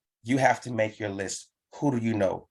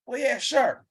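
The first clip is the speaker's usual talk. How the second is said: agreeing friend; 11 semitones higher than usual. Acted.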